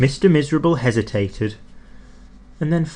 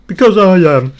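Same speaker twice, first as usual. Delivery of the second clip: sulky retort; 6 semitones higher, 11 dB louder than usual. A narrator's reading. Mister Miserable's stubborn answer.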